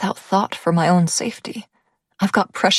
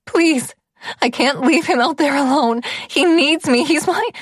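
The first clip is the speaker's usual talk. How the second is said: high, loud and desperate begging; plead, voice pitched up in panic; 9 semitones higher, 3 dB louder than usual. A narrator's reading. Begging guard to return to Lev.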